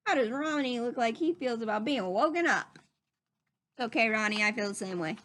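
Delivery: plain reading voice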